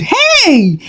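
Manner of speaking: high, stretched cry of protest; hammed-up narrated scream; mock scream. A narrator's reading. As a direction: call out high without real volume. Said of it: "Mama Sikiru screamed".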